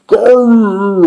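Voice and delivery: long big-bear "grrr" roar; Papa Bear's roar. A narrator's reading. Papa Bear notices the chairs, roaring growl. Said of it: "roared Papa Bear in his great big voice".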